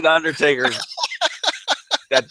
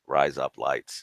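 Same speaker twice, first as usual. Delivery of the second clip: low, soft Australian "razor blades"; Australian accent; 11 semitones lower, 9 dB quieter, slower than usual. Shown as a demonstration. Co-host testing the razor blades trick, low Australian accent. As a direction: murmur it low, as an Australian would. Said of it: "it's an Australian person saying razor blades".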